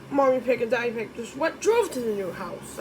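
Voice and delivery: plain reading voice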